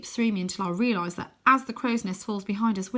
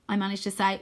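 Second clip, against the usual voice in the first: ordinary delivery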